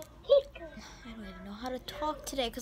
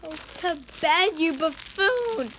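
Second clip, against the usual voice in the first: loud, slow, drawn-out order; mock scolding; 3 semitones higher, 6 dB louder, slower than usual. Child ordering the toy to bed, loud mock scolding. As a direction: stretch every word out loudly at a naughty toy. Telling toy to sleep, now loudly.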